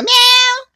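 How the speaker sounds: very high, loud imitated meow; be the cat at dawn. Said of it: "Deu cinco horas da manhã". (miado de gato, agudo e alto)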